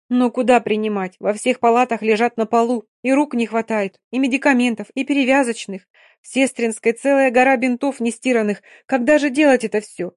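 high, loud desperate pleading; distraught. Acted. Dina despairing to the doctor about overflowing wards. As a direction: pour out the despair, near tears.

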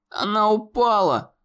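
high, desperate cry; exclaiming. A narrator's reading. Conor crying out to the monster, desperate. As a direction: fling the words back in despair. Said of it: "воскликнул Конор".

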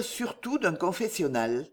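ordinary delivery, nothing marked

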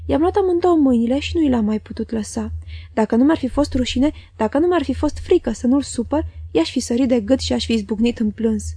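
plain reading voice

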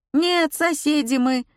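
high-pitched granny voice; old woman voice. A narrator's reading. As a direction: voice her as a village granny. Valya voiced in a story.

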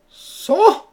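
the duke bursts out, loud and high; outburst. Acted. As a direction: explode with impatience. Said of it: "éclata le duc d'Anjou".

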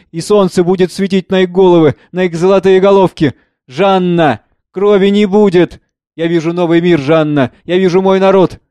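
impassioned Emil, prophetic. Acted. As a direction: proclaim hope to a grieving mother, loudly. Emil's prophetic speech to Jeanne. (торжественно, пророчески)

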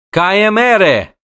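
high, loud, slow-stretched cry; urgent call. Acted. Dryad calls out as the girl flees, urgent shout. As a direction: shout the foreign words after a runaway.

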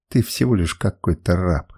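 low-pitched, restrained outburst; understated cry. A narrator's reading. Woman rejects the slave boy, understated low cry. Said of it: "закричала она".

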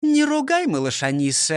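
high-pitched teacher's reproof; high, mild telling-off; reproving. A narrator's reading. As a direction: pull him up sharply, pitched high. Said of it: "одёрнула его Фрёкинсталь".

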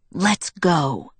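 low, hard voice. A narrator's reading. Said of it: "in a low, hard voice".

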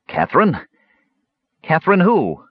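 high, uncertain kender questioning; lost and frightened. A narrator's reading. Bewildered kender asking about Catherine, lost and frightened. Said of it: "sounding lost and frightened".

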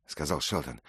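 ordinary delivery

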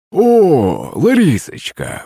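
put-on slow, drunken drawl on the name; drawn-out drunk greeting. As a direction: stretch it out like a happy drunk. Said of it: "протянул Захарыч". (пьяно протянуто, медленно)

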